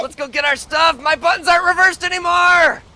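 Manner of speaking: very high, loud cartoon cheer; excited squeaky shout. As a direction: yell it like an eager tiny hero.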